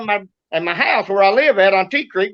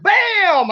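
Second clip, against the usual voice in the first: gunshot sound; 10 semitones higher than usual. Retold or imitated. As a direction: mimic a gunshot. Gunshot imitation.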